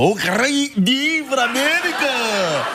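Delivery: slow, drawn-out American accent mocking tourists. Mocking how people greet him in an American accent. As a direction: lay on a cheesy American accent. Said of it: "when you do their accent too".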